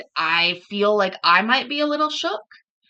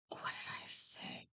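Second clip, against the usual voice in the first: a whisper to herself, barely voiced; 22 dB quieter than usual. Real, not acted. Streamer asking herself mid-stream, in a whisper. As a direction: breathe the words out under the breath.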